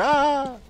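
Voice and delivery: sharp high startled yelp. Real, not acted. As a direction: yelp in sudden fright. Sudden scare by little creature.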